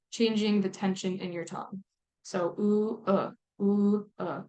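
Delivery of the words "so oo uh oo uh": oo tense, uh relaxed, back and forth; tense oo against lax uh. Shown as a demonstration. Teacher shows students the tense oo and lax uh. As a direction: alternate oo and uh, tense then relaxed tongue. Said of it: "You can feel the difference there".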